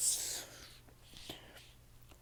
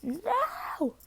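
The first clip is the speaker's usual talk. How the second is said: a high wailing no as the door slams; a high pleading cry at the door; high desperate cry; 11 semitones higher than usual. Retold or imitated. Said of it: "she's like".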